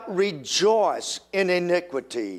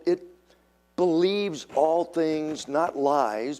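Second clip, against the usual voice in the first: ordinary talk, nothing marked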